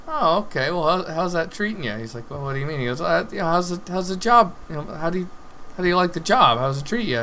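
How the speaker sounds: curious traveler. Retold or imitated. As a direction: ask like a chatty, mildly curious stranger. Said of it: "And he goes".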